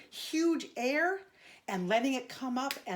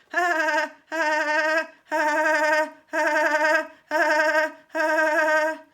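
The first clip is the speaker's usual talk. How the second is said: fast bouncing diaphragm laugh, loud; Woody Woodpecker laugh; 3 semitones higher, 8 dB louder than usual. Shown as a demonstration. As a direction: spasm a rapid cartoon laugh from the belly. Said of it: "very fast, like, diaphragmatic, like, spasming and also bouncing".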